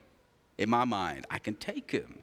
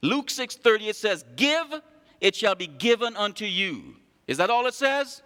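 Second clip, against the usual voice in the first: raised, ringing pulpit voice; full-force preaching; 8 semitones higher, 4 dB louder than usual. Acted. Pastor preaching to the church, raised and ringing. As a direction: preach it out to the congregation.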